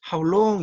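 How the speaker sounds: How long punched high and loud; stress on How long. Shown as a demonstration. Teacher showing strong stress on How long. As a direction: hit long hard and high. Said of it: "이건 강하게 읽어요".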